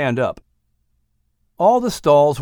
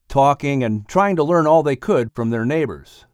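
ordinary delivery, nothing marked

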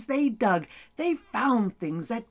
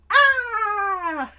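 startled gasp; 10 semitones higher, 5 dB louder than usual. Acted. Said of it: "leaned back and went".

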